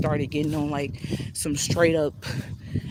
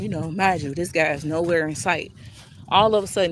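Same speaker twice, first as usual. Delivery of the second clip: ordinary delivery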